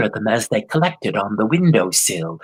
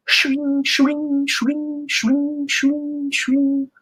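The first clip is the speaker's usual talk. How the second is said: sleigh sound; much slower than usual. A narrator's reading. Sleigh bells sound effect.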